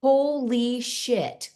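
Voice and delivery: awed. Retold or imitated. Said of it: "I'm like".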